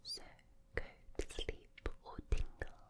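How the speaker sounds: English rose accent. Shown as a demonstration. Telling the listener to sleep, whispered English rose accent. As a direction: lull them in whispered Southern English. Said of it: "with the English rose accent".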